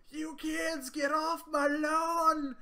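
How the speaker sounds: put-on high, loud, slow cranky old man voice; grumpy old man. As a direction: yell it like a cranky old man chasing kids. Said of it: "I'm like an eighty-four-year-old man?".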